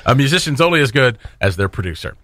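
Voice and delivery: usual speaking voice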